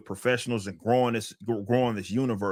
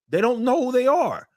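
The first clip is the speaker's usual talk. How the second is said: exasperated high; 14 semitones higher, 4 dB louder, faster than usual. Real, not acted. Exasperated rant peak.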